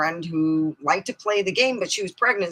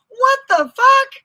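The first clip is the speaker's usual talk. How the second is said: mock shocked outburst; 11 dB louder, slower than usual. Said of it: "I'm like".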